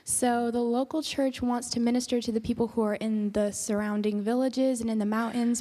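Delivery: ordinary delivery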